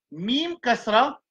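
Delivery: slow "Mim, kasra" with a rise on "Mim"; patient reading teacher; slow spelling demo. Shown as a demonstration. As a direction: sound it out slowly so students follow.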